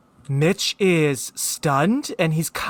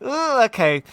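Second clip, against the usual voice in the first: cringing reaction; 7 semitones higher than usual. Retold or imitated. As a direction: wince aloud, pitched up. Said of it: "And I'm like".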